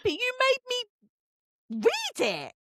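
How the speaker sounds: very high, drawn-out mock wail; exasperated protest. Reader protesting to cousin who recommended book, mock exasperation. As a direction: protest slowly at the top of your voice.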